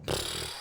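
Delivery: long dismissive lip puff; imitated Belgian puff. Showing a Belgian French speakers' habit, imitated puff. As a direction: blow air out through loose lips. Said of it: "in Belgium should go".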